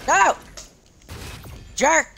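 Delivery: high, loud bursts of protest; angry yell. Real, not acted. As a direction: yell single words at the attacker. Attacked by player in game.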